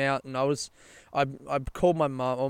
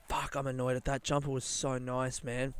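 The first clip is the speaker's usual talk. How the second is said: usual speaking voice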